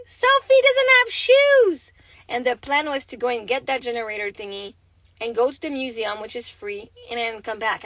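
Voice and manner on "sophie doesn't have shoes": put-on high, slow, whiny child voice; daughter's voice. Mother imitating daughter complaining in car, whiny child voice. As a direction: mimic the kid whining from the back seat. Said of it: "Emily's like".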